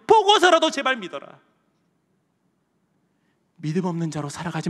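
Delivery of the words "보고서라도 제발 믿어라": loud, high-pitched plea to believe; 예수님의 간절한 외침. Acted. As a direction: cry out the appeal urgently. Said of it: "뭐라고 말씀하시냐면". (높고 크게 외치는 호소)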